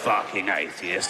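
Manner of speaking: low muttered insult; low sneering zealot. Retold or imitated. Fundamentalist in hell, low muttered sneer. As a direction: grumble it low and bored.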